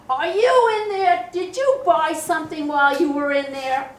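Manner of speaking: a friend yelling into the toilet stall to catch her eating; shouting through the door. Retold or imitated. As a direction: bark it like a friend barging into the bathroom. Friend bursting into bathroom, calling.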